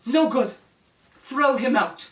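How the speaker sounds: high, loud royal yell; yelling princess. Acted. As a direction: bark the order to throw him out. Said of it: "the princess yelled".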